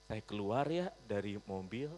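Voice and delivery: ordinary talk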